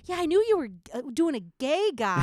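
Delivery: high, loud, re-enacted excuse; retold flustered recognition. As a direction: act out insisting you knew all along. Acts out covering his wrong guesses.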